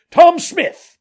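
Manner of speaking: high, full-voiced shout to someone far off; high-pitched, hailing yell; loud calling out. A narrator's reading. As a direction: holler it as loud as you can. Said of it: "calls out as loud as he could".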